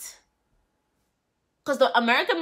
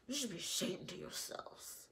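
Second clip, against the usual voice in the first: hushed, breathy reproach; whispered scolding; 4 semitones lower, 20 dB quieter than usual. Real, not acted. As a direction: whisper it like a disappointed aunt. Scolding the family on show.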